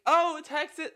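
high, loud yell over club music; screamed answer. Retold or imitated. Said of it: "Like, scream".

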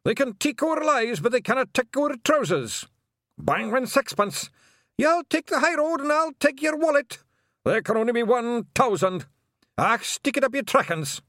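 very high, loud Scottish war cries. A narrator's reading. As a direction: yell battle cries over the din. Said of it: "the war cry of the Nac Mac Feegle".

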